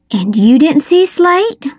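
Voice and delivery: incredulous question climbing in pitch; voice rising. A narrator's reading. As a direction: let the voice climb in disbelief. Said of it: "my voice rising".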